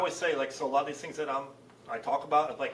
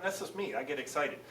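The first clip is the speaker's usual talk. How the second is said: ordinary talk, nothing marked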